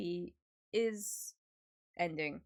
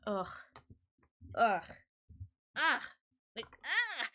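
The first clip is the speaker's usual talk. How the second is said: emotional groans; 5 semitones higher, 5 dB louder than usual. Real, not acted. Overwhelmed by vacation memories.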